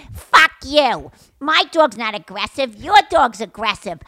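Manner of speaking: pissy dog owner. Retold or imitated. Retelling a woman defending her dog, loud angry comeback. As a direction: fire back like an insulted dog owner. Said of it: "fucking whacked and getting all pissy".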